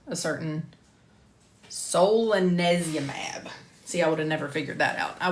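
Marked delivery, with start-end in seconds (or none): 1.7-3.5 slow, drawn-out solanezumab; careful pronunciation. Shown as a demonstration. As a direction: sound out solanezumab slowly, as just looked up. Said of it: "how to pronounce".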